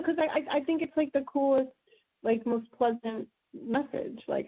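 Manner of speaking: ordinary talk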